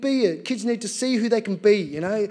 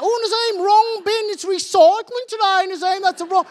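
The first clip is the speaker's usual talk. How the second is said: much higher, louder busybody lady; nagging old neighbour; 11 semitones higher, 4 dB louder than usual. Retold or imitated. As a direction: scold him about the bins in a high old-lady voice. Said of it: "every little thing I do".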